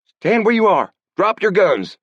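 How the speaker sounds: shrill parrot shout; shrill harsh cry. Acted. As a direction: shriek it like a parrot. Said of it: "a shrill but harsh voice cried".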